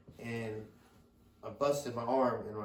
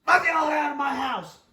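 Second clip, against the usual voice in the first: much higher, louder yelled command; mother kicking everyone out of her house, shouted; mom shouting; 13 semitones higher, 10 dB louder than usual. Retold or imitated. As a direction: throw them all out, shouting. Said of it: "my mom, your grandma, says".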